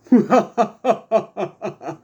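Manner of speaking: a high, real burst of laughter; loud laugh breaking out; amused laugh. Real, not acted. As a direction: laugh at your own voice. Laughs at own impression.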